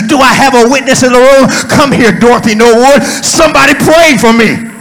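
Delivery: driving cadence rising to shouted peaks; full-force preaching. Real, not acted. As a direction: preach it hard to stir the congregation.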